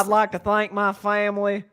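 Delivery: slow, hammed-up Southern drawl; stretched Southern vowels, imitating a speech; mock Southern accent. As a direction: imitate Brian Kelly's put-on Southern twang. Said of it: "uh, Southern accent".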